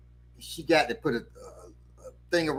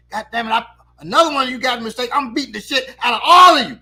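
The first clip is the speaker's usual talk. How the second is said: loud, high comic outburst building to a yell; hammed-up furious teacher, punching the end; retold angry threat; 8 semitones higher, 10 dB louder, faster than usual. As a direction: threaten the whole class at rising volume. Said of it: "Just like that".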